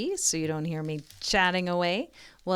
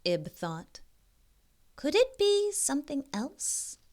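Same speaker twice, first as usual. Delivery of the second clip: slow storybook reading voice; story narration; 2 semitones higher, much slower than usual. A narrator's reading. Reading a picture book aloud to young kids, gentle storybook narration. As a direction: read it like a bedtime picture book.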